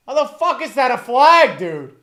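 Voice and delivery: loud, high angry shout; outraged protest. Real, not acted. Fan protesting a penalty flag, outraged shout. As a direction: protest the call in real disbelief.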